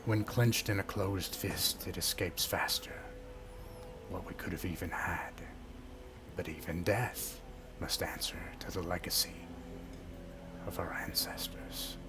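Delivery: hushed, whispering ancient tree spirit; talking tree. Acted. Spirit tree speaks to hero.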